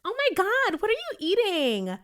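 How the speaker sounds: high, sugary fake-friendly voice; imitating a passive aggressive coworker, high and syrupy; fake sweet coworker. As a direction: gush with fake delight over lunch. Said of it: "and be like".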